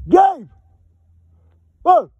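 loud, high, short shouts; calling out. Real, not acted. Hiker trying to get a companion's attention, calling out.

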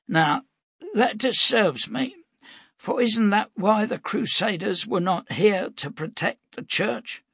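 put-on high, pointed old man's voice; old prophet, suspicious. Cade probing the captain, suspicious old prophet. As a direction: lay out the suspicion piece by piece.

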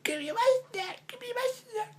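very high voicing of a hungry cat, trailing off into a whiny cry; be the cat begging for its snack; squeaky demanding kitty voice.

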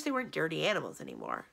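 ordinary delivery

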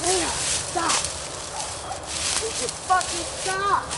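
high, strained call to the dog; exasperated calling after the dog; angry calling. Real, not acted. Yelling at dog off leash.

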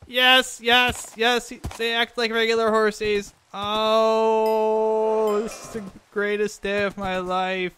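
high, loud, elated cries; triumphant joy. Real, not acted. Player celebrating the saddled horse, triumphant and high. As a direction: crow with delight, pitch way up.

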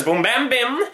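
high, punchy bang noises; gunshot sounds. A narrator's reading. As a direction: fire off the shots like a kid playing guns. Gunfire sound effects in story.

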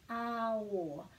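"awo" drawn out slowly, a then o; vowels sounded separately. Shown as a demonstration. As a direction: stretch "awo" so both vowels are heard. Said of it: "breaking them at every vowel".

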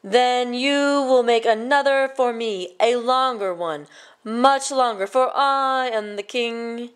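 put-on king ordering the boy, slow and grand; commanding king. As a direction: lay down the law as a greedy king. King demands a longer scarf.

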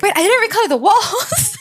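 high, laughing panic at a sudden realization; panicking. Real, not acted. Gamer spots unpainted walls against the timer, panicking. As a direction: blurt it out, flustered and half laughing. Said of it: "how much I'm panicking".

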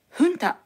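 フンタ starting with an H sound, "HOON-ta"; Spanish-style junta. Shown as a demonstration. Teacher quoting the newscaster's pronunciation, フンタ with an H. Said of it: "と発音しています".

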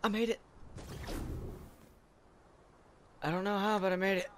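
high, loud triumphant cheer; excited. Real, not acted. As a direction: shout it out in disbelieving triumph. Triumphant after finally clearing jump.

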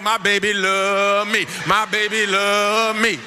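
slow, sing-song wail of a man falling apart; broken-down husband. Retold or imitated. Husband falling apart over his wife's care, slow sing-song wail. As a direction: melt into it like he's coming undone. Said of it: "won't he fall all the way apart and be like".

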